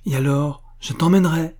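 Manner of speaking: lightly elated reply; joyful. A narrator's reading. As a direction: answer her gladly, lifting the voice. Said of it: "répondit joyeusement Boris".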